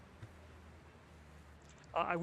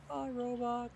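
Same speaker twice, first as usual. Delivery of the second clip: much higher, cartoonish little voice; squeaky goodbye; 9 semitones higher, slower than usual. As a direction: say bye like a tiny cute robot. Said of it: "Say goodbye to the robot, everyone".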